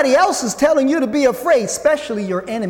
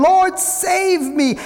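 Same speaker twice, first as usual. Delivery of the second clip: cried out; 8 semitones higher, much slower than usual. A narrator's reading. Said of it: "cried out".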